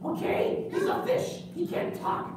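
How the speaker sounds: put-on high, breathy, offended boy; a kid correcting the crowd; high puppet boy, huffy and offended; indignant puppet kid. Puppet Gus argues.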